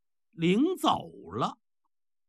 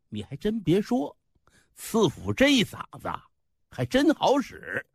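ordinary delivery, nothing marked

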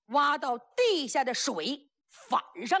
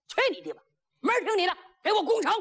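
angry order; 7 semitones higher, much faster than usual. Acted. Commander brushes off his advisor, angry rising order. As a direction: dismiss him and bark the order.

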